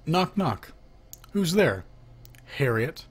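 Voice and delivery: usual speaking voice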